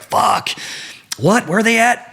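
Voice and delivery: startled, rising to a high yelp; jolted awake and confused; retold startled outburst. Startled man woken by call.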